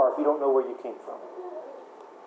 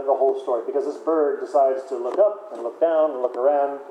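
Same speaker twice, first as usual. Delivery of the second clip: ordinary delivery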